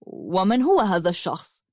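ordinary delivery

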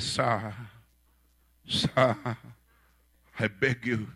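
halting plea, broken by pauses; hesitant, imploring appeal; pleading. Acted. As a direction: beg for mercy, word by word. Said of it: "So he, he begged".

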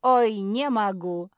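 loud, slow, pained moan; drawn-out wail of distress; groaning. A narrator's reading. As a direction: groan it out loudly. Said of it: "простонал Ричард".

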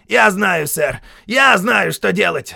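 высокий громкий голос злорадного сержанта; прокричать с торжеством перед ударом; злорадный сержант. A narrator's reading. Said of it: "сказал Хейксвилл". (сержант торжествует, нанося удар)

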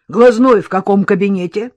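loud, demanding patient's question; brusque loud query. A narrator's reading. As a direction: call it loudly across a counter. Burly patient demands at counter.

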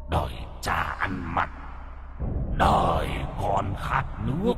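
soft, hollow cursing voice; ghostly curse. A narrator's reading. Robed ghost cursing the descendants, hollow and soft. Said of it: "như là nguyền rủa".

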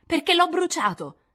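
molto acuto e forte; trionfo maligno. Acted. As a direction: lo sbatte in faccia. Amy confessa con sfida. (Amy confessa a Jo con trionfo maligno)

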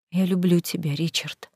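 soft tearful near-whisper; whisper sobbing. Acted. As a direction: breathe it through held-back tears. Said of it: "прошептала сквозь слёзы".

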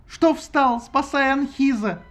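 high loud panicked orders; frantic yelling. Acted. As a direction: yell it in a panic. Goddess screaming orders.

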